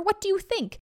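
high, impatient tiger; exasperated tiger. A narrator's reading. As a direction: throw the question back in irritation. Tiger exasperated by silly question.

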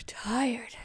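unvoiced whisper, drowsy; whispered tired word as sleep comes; whispered sleepy. A narrator's reading. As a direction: drift off as you say it. Falling asleep.

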